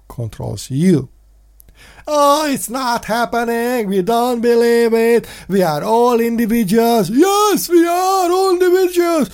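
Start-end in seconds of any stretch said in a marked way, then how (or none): 2.1-7.1 mocking quote. Mocking people who deny it. 7.1-9.3 high, shouted sarcastic echo; sarcastic squeaky chant; mock shout.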